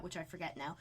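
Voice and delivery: ordinary talk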